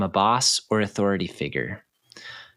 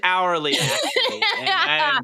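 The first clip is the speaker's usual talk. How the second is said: laughing; 12 semitones higher than usual. Pretend. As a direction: laugh through a mock boast. Jokingly plays hired professional.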